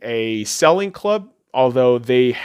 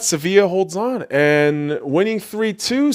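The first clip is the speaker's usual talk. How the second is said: ordinary delivery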